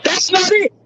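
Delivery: real angry outburst; triggered. Real, not acted. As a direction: cut them off, fired up and indignant. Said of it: "now let me calm down".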